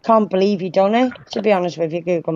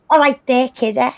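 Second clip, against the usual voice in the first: higher-pitched imitation of a Scouse greeting; Scouse accent; 6 semitones higher than usual. Teasing a friend about their first meeting, imitated Scouse accent. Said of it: "Try and talk in a Scouse accent".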